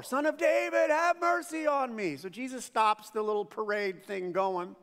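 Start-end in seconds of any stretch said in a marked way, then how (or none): 0.0-2.2 shouting more. Retold or imitated. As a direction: yell it over the crowd, pleading. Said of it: "he shouted all the more".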